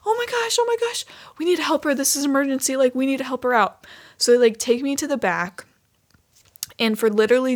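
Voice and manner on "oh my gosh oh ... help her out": much higher, rushed and eager; excited girls. Retold or imitated. Stylists rushing to help a customer, high excited squeals.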